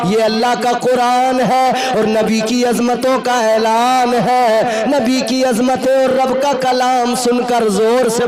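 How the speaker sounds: loud, ringing sing-song sermon delivery; full-force preaching. Real, not acted. Preacher calling congregation to praise the Prophet, chanted exhortation. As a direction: proclaim it and drive the crowd to respond.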